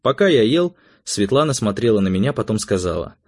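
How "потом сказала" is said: usual speaking voice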